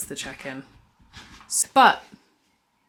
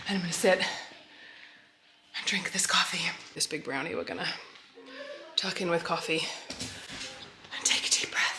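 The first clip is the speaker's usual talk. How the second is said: whispering; 5 dB quieter than usual. Real, not acted. Whispered while sitting with coffee.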